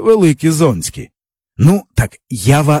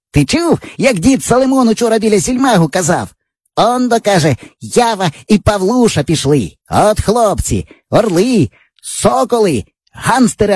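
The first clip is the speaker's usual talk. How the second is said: put-on higher eager boy voice; boyish friend; 7 semitones higher than usual. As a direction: brag like a cocky village boy. Java retells old man's praise.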